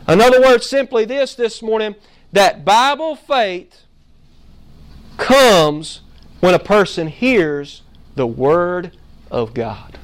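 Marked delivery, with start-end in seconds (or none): 2.3-10.0 slow, weighted climax; slow emphatic statement. Real, not acted. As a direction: lean on every word.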